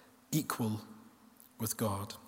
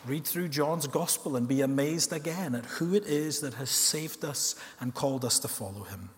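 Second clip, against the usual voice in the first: usual speaking voice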